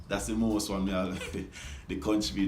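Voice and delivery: ordinary delivery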